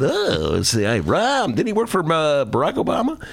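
puzzled voter voice; befuddled voter. Acted. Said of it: "Kinda, like, befuddled".

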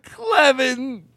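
high-pitched put-on name; silly foreign-name voice.

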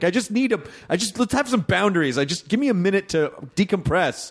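high, pleading self-quote; acted-out exasperation; mock frazzled plea. Acts out pleading for space.

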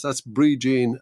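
normal talking voice

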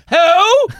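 high, loud blurted question; imitating mom's loud outburst; mom calling out. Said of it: "she'll go".

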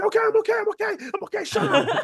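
very high, laughing through it; giddy reassurance. Retold or imitated. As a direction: brush them off while giggling. Reassuring roommate while overexcited.

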